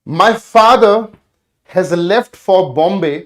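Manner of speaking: very slow, word by word reading; teacher dictating the exam question; slow dictation. Shown as a demonstration. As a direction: read the question out slowly to be copied. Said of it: "second question".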